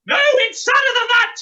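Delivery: loud, high exclamation; exclaiming. Acted. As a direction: protest it loudly. Dame insists, louder.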